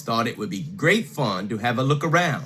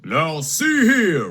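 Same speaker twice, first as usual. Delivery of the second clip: slow, louder papa bear bluster; mock roar; 4 semitones higher, 4 dB louder, slower than usual. Papa Bear confronting Goldilocks with a slow mock roar. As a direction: puff up like an outraged father. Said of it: "roared Papa Bear".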